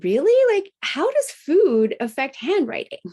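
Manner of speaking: high, loud startled "Really?" then settling; mock astonishment at the idea; surprised disbelief. Mother asking the teacher, in surprised disbelief.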